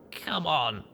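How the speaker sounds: pained groaning plea. Acted. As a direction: groan the words out in pain. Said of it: "I groaned".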